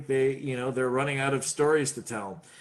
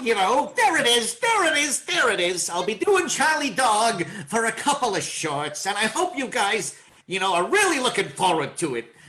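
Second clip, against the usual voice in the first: high, loud cartoon dog voice; Charlie Dog voice; 7 semitones higher, 4 dB louder than usual. Shown as a demonstration. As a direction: bark it out like an eager cartoon mutt. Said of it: "I talked about Charlie Dog".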